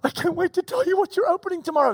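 high, fast giddy rush; can barely hold the secret in; bursting with excitement. Pretend. Gift giver bursting with excitement.

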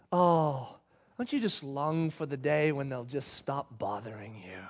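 low, soft, wistful parent; sit back and sigh it out; hushed, low-pitched daydream of relief; weary longing. Acted. Weary parent sits back, longs.

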